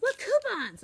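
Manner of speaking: put-on very high, loud kid insisting; excited child. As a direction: shout it like a thrilled first-grader. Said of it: "But she goes".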